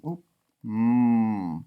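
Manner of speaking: usual speaking voice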